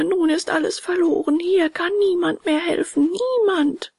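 high, hopeless wail; wailing lament. A narrator's reading. Puppet buried alive inside the shark, wailing that help is impossible. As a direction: moan in despair, pitched high throughout. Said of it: "jammerte er".

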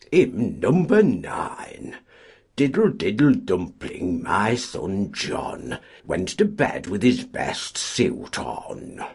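slow, loud hymn-announcing parody; mock-solemn announcement. A collier parodies the choirmaster's hymn call, slow and solemn. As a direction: intone it like a chapel notice. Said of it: "came the solemn announcement".

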